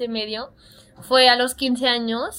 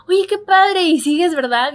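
higher, louder gushing acquaintance; mock partygoer; 5 semitones higher, 5 dB louder, slower than usual. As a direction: gush like someone who just recognized you. Partygoer recognizing her, retold.

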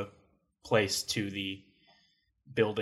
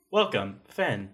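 ordinary talk

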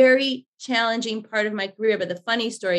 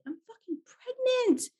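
mock-exasperated blurt, pitched much higher; exasperated confession; 5 dB quieter than usual. Pregnant director answering nosy crew, high exasperated blurt. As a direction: throw it out like it should be obvious. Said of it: "And I was like".

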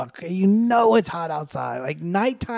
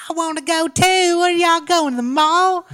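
put-on much higher, louder chattering Texan girl; whiny Texas twang; 11 semitones higher, 4 dB louder than usual. Friend mimics the kid begging to come along in a Texas accent. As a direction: rattle it off high and loud in a Texas accent. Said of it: "she has the worst accent of all".

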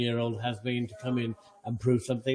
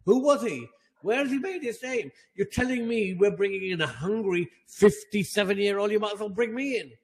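exasperated, higher-pitched outburst; incredulous rant; 6 semitones higher than usual. Real, not acted. Fan disputing a fifty-seven-year-old manager hire, incredulous rant. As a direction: demand answers in raised-pitch disbelief.

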